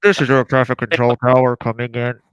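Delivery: mock radio-operator announcement; air traffic controller. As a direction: play a control tower calling over the radio. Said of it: "when I'm being Melbourne Air Traffic Control".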